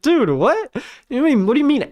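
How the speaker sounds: high-pitched mock bewildered boy; incredulous kid impression, high voice; baffled kid. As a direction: imitate a confused son, pitched well up. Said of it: "He's like".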